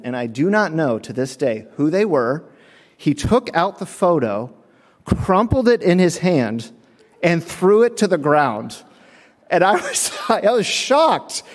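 ordinary talk

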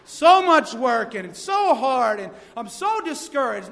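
slow, drawn-out mock complaint; hammed-up weary whining; mock discouraged. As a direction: moan it like a put-upon worker. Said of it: "they look like they're just, somebody's licked the stripes".

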